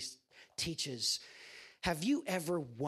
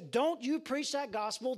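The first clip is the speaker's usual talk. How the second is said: mock bold shout; 7 semitones higher than usual. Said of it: "scream and shout and be big and bold".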